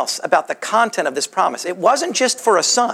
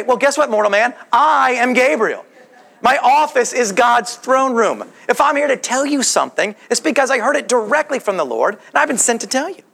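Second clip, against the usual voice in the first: sarcastic comeback; 5 semitones higher than usual. Acted. Angel puts mortal man in his place, sarcastic comeback. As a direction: pull rank with pointed sarcasm. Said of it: "gives Zechariah's sarcasm right back to him".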